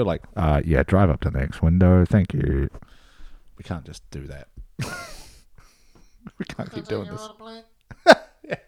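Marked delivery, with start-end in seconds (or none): 0.4-2.7 tinny drive-through intercom voice; like a crackly drive-thru speaker. Retold or imitated. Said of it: "very McDonald's drive-through tone to the audio".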